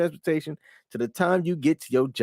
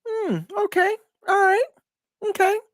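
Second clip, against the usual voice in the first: pitched way up high, setting up a comeback; voice going up. Real, not acted. Podcast host warning co-host a rebuttal's coming, high-pitched. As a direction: climb high before hitting back with a point. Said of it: "My voice is going up".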